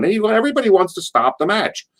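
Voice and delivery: high-pitched imitated onlooker; crowd's reaction. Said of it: "crowd's looking at this going".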